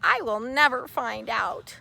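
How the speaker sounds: usual speaking voice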